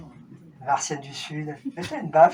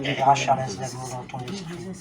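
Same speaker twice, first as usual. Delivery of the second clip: normal talking voice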